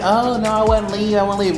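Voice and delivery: high whiny denial; high mock voice. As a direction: mimic someone refusing to leave. Imitating someone in denial.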